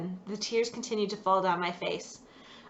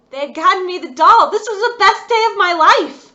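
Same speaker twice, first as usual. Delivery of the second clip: put-on high, loud burst of childish joy; a thrilled girl's loud shout of joy; overjoyed; 10 semitones higher, 15 dB louder than usual. A narrator's reading. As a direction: squeal it out with delight.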